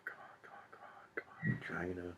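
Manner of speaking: hushed, rapid whisper under the breath; whispered urging. Real, not acted. Reader hunting through pages for the dirty part, whispering impatiently. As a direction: whisper it urgently to yourself.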